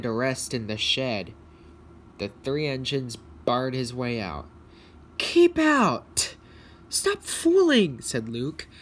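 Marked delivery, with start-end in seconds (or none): 5.2-8.0 high, loud cry of protest; indignant shout. Acted. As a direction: shout up high at those blocking you. Engines bar tired Luke's way.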